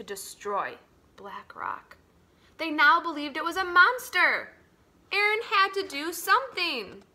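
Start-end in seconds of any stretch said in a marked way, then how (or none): 2.6-7.0 urgent. A narrator's reading. Adults plot, urgent turn.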